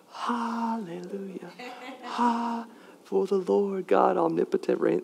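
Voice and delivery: hushed, slow whispered lyrics; very soft, drawn-out mock choir whisper. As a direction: whisper the praise feebly, as a joke. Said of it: "all they did was whisper the lyrics".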